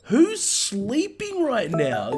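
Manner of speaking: high, drawn-out disbelief; incredulous outburst. Real, not acted. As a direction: stretch it out high, utterly incredulous. Incredulous outburst at film characters.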